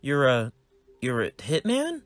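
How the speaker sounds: put-on high, bright kid voice, eager; a nerdy boy greeting his dad; cheerful young son. Son Wade shocked.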